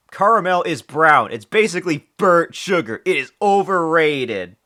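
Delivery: high, loud mock outrage; loud rant. Real, not acted. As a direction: rant it at the top of your voice. Loud rant about caramel.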